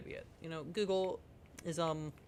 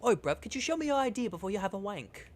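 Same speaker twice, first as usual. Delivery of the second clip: higher cockney bruv voice, cheeky; mock British accent; 6 semitones higher than usual. Mocking the UK government to chat in a British accent. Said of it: "The U K government".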